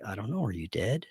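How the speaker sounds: low, soft, strangely calm Corey; the friend turned uncanny, quietly asking if he's dead; eerie friend. Retold or imitated. Corey answers eerily, not himself.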